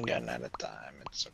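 soft unvoiced whisper. Real, not acted. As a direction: whisper so nobody hears. Whispering while looting.

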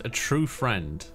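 normal talking voice

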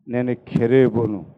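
low, slow, hushed angel voice; a solemn heavenly being speaking low and slow; cherub's answer. Retold or imitated. Cherub answering the praying brother, low and slow.

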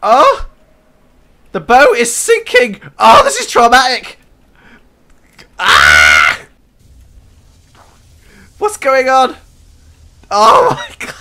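high, loud frantic cries; alarmed yelling. Real, not acted. Gamer on the sinking ship yells in alarm. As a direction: yell in alarm as it sinks.

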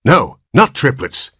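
high, slow cutting reply; doctor cuttingly dismisses the father. A narrator's reading. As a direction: slice the words coldly. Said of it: "answered the doctor cuttingly".

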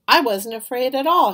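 loud, high boastful claim; bragging. Acted. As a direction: brag it, chest puffed out. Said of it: "he bragged".

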